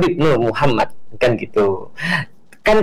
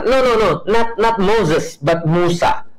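mock insistent correction; 5 semitones higher, slower than usual.

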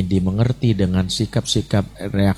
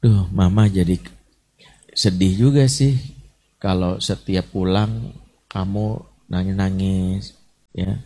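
gentle sad mother; 3 semitones lower than usual. Retold or imitated. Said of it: "I message itu rumusnya apa".

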